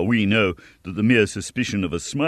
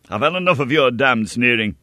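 louder, higher furious challenge; angry shout; 4 semitones higher, 5 dB louder than usual. A narrator's reading. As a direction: hurl the insult back.

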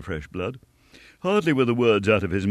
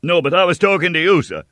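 much higher, louder, fast retort; stung cry; 10 semitones higher, 7 dB louder than usual. A narrator's reading. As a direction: snap back, stung and loud. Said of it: "cried the young man".

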